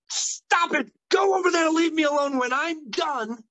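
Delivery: put-on frazzled dad shouting the kid away; old school parent yelling. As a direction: snap at the child to leave you alone. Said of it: "the old school way was to be like".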